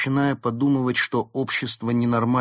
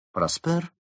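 higher-pitched surprised question; 8 semitones higher than usual. A narrator's reading. Fox hearing of a famous detective, surprised. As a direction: echo the name in surprise. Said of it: "удивился Улис".

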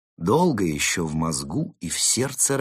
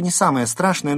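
high, fast, louder remark; brisk, matter-of-fact; 9 semitones higher, 6 dB louder than usual. Acted. Fox playing down Mole's danger, brisk. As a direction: toss it off quickly and briskly.

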